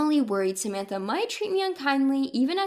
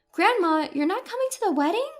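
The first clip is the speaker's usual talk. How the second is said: surprised granddaughter; 7 semitones higher, 3 dB louder than usual. Acted. Granddaughter hears grandma won't come.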